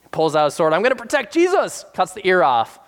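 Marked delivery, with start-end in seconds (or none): 0.7-1.8 bold vow. Retold or imitated. Peter drawing his sword.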